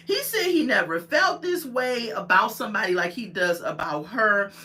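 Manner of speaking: ordinary talk, nothing marked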